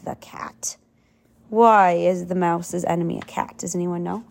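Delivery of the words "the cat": dropped to a hushed whisper. A narrator's reading. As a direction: whisper the enemy's name. Read-aloud story narration.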